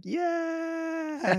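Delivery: high stretched mock cheer yeah; a crowd rallying to a revolution, mock. Imagined crowd cheering him, high drawn-out yeah. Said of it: "Everyone's like".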